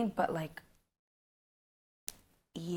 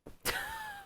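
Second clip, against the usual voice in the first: high squeal laugh; 9 dB quieter than usual. Real, not acted. Laughs at own joke.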